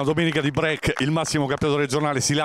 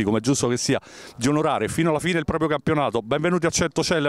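ordinary talk

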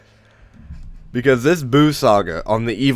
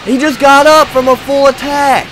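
much higher and louder, incredulous; outraged shout; 11 semitones higher, 4 dB louder than usual. Acted. Gamer shouting to his audience that the enemy is really mad. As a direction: yell it like a disbelieving announcer.

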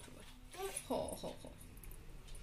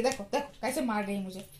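usual speaking voice

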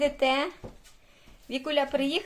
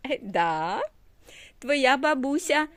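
ordinary talk

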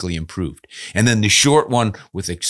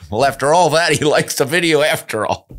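laughing relief; 8 semitones higher, 5 dB louder than usual. Real, not acted. Relieved after harsh letter.

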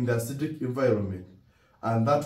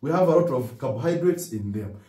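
usual speaking voice

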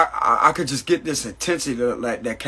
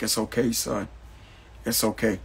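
mother's soothing voice; 8 dB quieter than usual. Retold or imitated. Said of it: "She's like".

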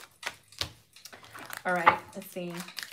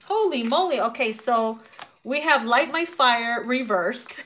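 ordinary talk

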